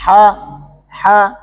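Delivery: ہا، said softly with breath, not hard. Shown as a demonstration. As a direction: breathe out ہا gently. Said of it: "ہا کے اندر آپ نے ایک یہ خیال رکھنا ہے". (نرمی سے ہا)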